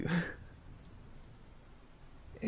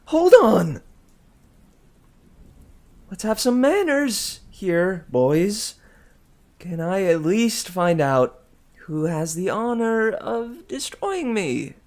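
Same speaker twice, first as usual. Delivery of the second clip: higher, loud protest; cried out; 9 semitones higher, 7 dB louder than usual. A narrator's reading. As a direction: call out to stall them. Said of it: "but Leo cried".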